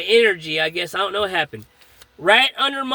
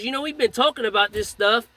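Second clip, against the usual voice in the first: usual speaking voice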